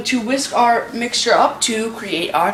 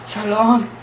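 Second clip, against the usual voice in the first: ordinary talk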